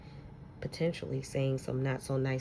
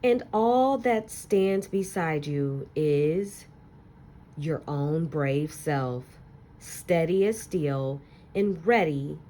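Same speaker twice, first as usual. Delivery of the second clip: put-on expressive read-aloud to children, lingering on key words; story narration; 3 semitones higher, 6 dB louder than usual. Teacher reading a picture book to her class, expressive storytelling.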